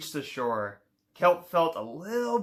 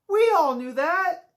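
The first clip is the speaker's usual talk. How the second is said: much higher, louder little friend voice; friends' knowing reply; 11 semitones higher, 4 dB louder than usual. Acted. Undersea friends tell Kelp they always knew, high chirpy voice. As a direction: chirp it brightly, pitched way up.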